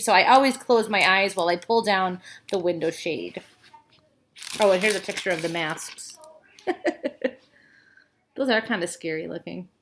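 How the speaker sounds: usual speaking voice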